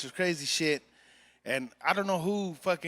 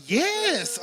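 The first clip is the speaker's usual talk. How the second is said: insistent; 9 semitones higher than usual. Retold or imitated. Retelling her insistent answer.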